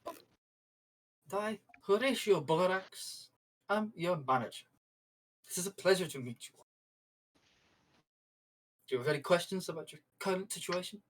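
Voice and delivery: lightly voiced company manager, measured and formal; Horatio Borax. Manager introduces himself to his new company property, lightly played formal politeness. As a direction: introduce yourself with deliberate pauses, as a polite corporate boss. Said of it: "looks at you and says".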